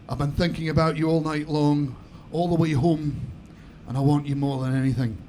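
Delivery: put-on low, intimate try at a Scottish accent. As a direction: seduce her in a put-on Highland voice. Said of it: "That didn't come out as bad as I thought".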